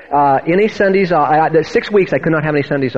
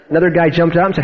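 normal talking voice

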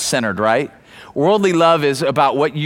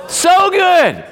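"so good" shouted much higher and louder; preacher hits the climax on "so good"; 6 dB louder than usual. Acted. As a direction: land the punchline with a big shout. Sermon punchline shout.

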